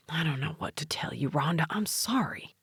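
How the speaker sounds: hushed, breathy apology under the breath; sheepish whisper. A narrator's reading. Husband whispering an apology to Rhonda, barely voiced. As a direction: whisper it like you can't face her.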